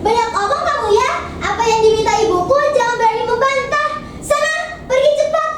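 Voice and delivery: high-pitched bossy retort; curt, snappish. Acted. Stepsister ordering Bawang Putih away, curt and snappish. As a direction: cut her off sharply, pitched high. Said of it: "jawab Bawang Merah dengan ketus".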